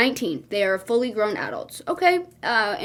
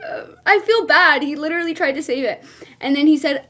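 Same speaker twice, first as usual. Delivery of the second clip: ordinary delivery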